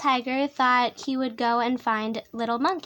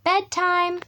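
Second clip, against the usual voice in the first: lightly voiced tiger mother, higher; Mommy Tiger calling; 6 semitones higher, 3 dB louder than usual. A narrator's reading. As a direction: sing out a parent's higher-pitched call. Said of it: "when Mommy Tiger said".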